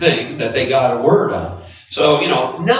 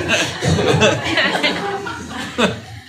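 real laugh; 12 semitones higher than usual. Real, not acted. Laughing at his own story.

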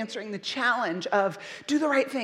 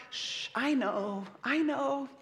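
softer, slow, soothing shush; hushing a crying baby; 7 dB quieter, much slower than usual. Acted. Parent soothing a worked-up crying baby, soft slow hush. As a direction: rock the baby with the words. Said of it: "some of you might have gone".

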